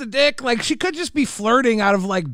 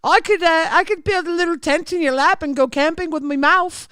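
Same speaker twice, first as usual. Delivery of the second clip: put-on seductive voice, pitched up; mock flirty woman; 5 semitones higher than usual. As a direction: play a woman coyly flirting. Imagined flirty woman's reply.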